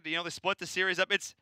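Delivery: normal talking voice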